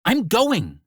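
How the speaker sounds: high, sharp Brooklyn snap; snapped. A narrator's reading. Mirabella snapping at Axel, Brooklyn accent. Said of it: "she snapped".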